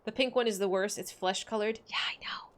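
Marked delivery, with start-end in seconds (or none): 1.9-2.5 quick hushed aside; whisper. Real, not acted. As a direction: whisper it behind a hand. Aside to chat.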